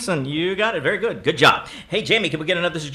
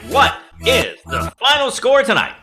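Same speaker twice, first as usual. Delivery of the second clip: game-show host; 4 semitones higher, 6 dB louder than usual. Real, not acted. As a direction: build suspense for the big reveal. Host calls for the final score.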